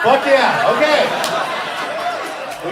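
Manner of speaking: a big rally cry of fuck yeah; loud shout. Acted. Shouting it back to crowd.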